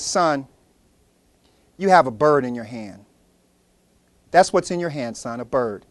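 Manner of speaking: low, soft and unhurried; a hint of a patient old man; calm old man. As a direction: settle the boy gently. Wise old man answers slowly.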